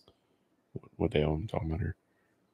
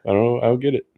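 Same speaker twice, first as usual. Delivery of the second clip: ordinary talk, nothing marked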